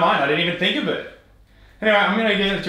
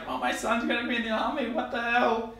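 higher, softer mock-excited voice; imitating excited mum; 7 semitones higher, 6 dB quieter than usual. Retelling mum's reaction to partner, imitated excitement. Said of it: "even more excited than me".

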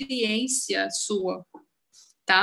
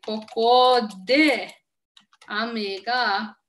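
slow demo; much slower than usual. Shown as a demonstration. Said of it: "Está chovendo aqui".

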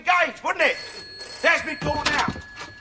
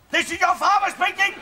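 raised voice, pulling rank; insistent father; 2 semitones higher than usual. Acted. Repeats it louder to daughter.

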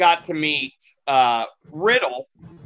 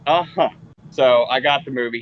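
ordinary talk, nothing marked

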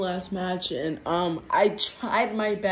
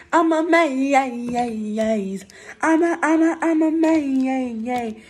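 slow dazed; 4 semitones higher, 5 dB louder, much slower than usual. Pretend. Character dazed.

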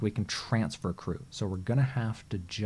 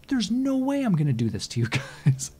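laughing refusal; 10 semitones higher, 6 dB louder than usual. Real, not acted.

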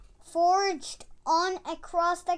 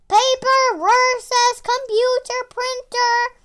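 loud, higher ring announcer call; fight announcer; 7 semitones higher, 9 dB louder than usual. Acted. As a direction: announce the matchup with a boom.

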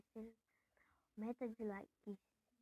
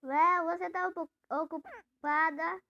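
loud call, much higher; 7 semitones higher, 14 dB louder, slower than usual. Real, not acted. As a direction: call across the house. Calling to cousin in house.

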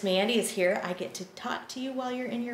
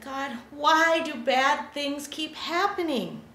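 pleading prophet; 7 semitones higher, 5 dB louder, slower than usual. Acted. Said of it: "And Habakkuk said".

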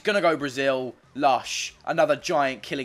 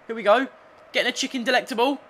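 excited; 10 semitones higher, 4 dB louder, much faster than usual. Real, not acted. Attack breaks through in match.

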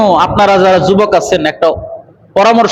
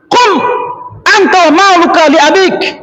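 high-pitched retold rebuke; angered outburst; 9 semitones higher than usual. Prophet rebuking a companion who complains of his father, angered outburst. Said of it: "নবীজি চেইতে গেছে".

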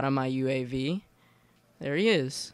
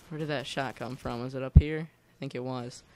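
ordinary delivery

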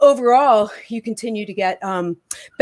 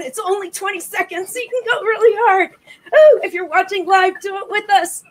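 higher, quick coaching patter; high-pitched coaching; 10 semitones higher than usual. Acted. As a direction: fire up the viewers. Coaching during the exercise.

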